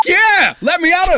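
high, loud, eager cry of yes; eager shout. Acted. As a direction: fire it off like a keen soldier itching to fight. Simon eager to charge.

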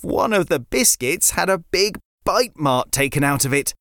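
plain reading voice

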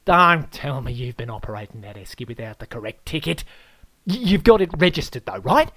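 loud, rising worried question; alarmed Aussie host. Acted. Host Aaron questions the guest about registration, loud and alarmed. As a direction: demand it in alarm.

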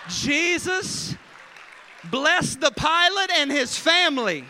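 loud, slow preacher-style thanks; hammed-up grateful prayer; fervent prayer. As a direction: pour out praise. Passenger prays thanks after relief.

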